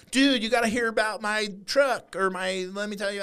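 high-pitched mock-eager fan; put-on high voice, eager to share; pestering fan. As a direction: imitate an overexcited fan cornering someone. Imitating fans who want to chat.

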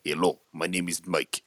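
put-on Bulgarian accent that comes out Russian; mock Bulgarian accent. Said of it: "He sounds Russian".

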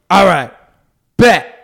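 loud, high imitated dare; mock confident. Tax man accepting the bet, hammed-up loud.

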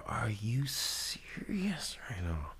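slow, breathless whisper of disbelief; incredulous whisper. Retold or imitated. To the armrest-hogging seatmate, a slow disbelieving whisper. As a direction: hiss it under your breath, slowly.

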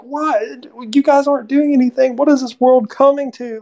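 high, indignant mock rant; ranting man. Imitating the man yelling at Laura and her dad, high indignant rant. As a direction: huff it out like a cranky old man. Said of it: "And he's like".

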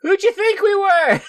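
very high, hammed-up indignant ninja; squeaky ninja voice. Game master voicing a ninja.

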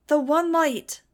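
high, loud rallying cry; crowd roar. A narrator's reading. Hysterical crowd answering the rally leader, roared chant. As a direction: roar it back as a whole crowd. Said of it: "the crowd roared".

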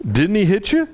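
high, incredulous dad question; upset father. Retold or imitated. Upset father questioning his crying son, high incredulous voice. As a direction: press your son in disbelief. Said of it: "he's like".

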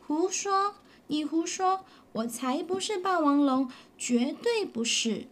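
put-on high, protesting child; 激动地否认; upset denial. Child denies angrily.

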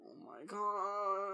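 stunned. Real, not acted. Reacting to heat advisory.

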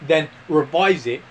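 Aussie explainer, pausing between phrases; mock Australian accent. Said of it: "Australia, mate".

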